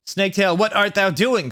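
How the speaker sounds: high, theatrical summons; hammed-up villain's call. A narrator's reading. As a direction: call to a servant imperiously. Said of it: "called Voldemort".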